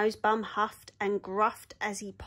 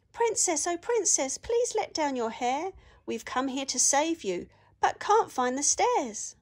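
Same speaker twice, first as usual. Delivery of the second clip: high, raised calls up to the princess; calling up the tower; 6 semitones higher, 3 dB louder than usual. Acted. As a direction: call up the tower wall to reach her. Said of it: "Danny shouted and called".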